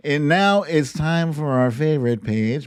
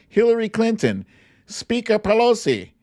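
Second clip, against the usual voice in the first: higher, punchy cast list; hyped cast roll call; 8 semitones higher, 4 dB louder than usual. Pretend. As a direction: call out the heroes like a hype man. Listing the cast with gusto.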